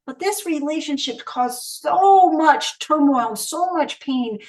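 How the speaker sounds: normal talking voice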